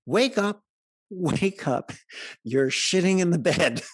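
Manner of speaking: wife shaking her husband awake, calling loudly. Acted. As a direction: rouse a sleeping husband loudly.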